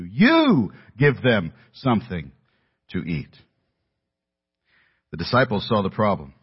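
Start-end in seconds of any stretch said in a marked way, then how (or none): 0.0-3.3 long, emphatic You at the start; stress on You. Shown as a demonstration. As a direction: punch and stretch You, then slow down. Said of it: "His emphasis was on the word".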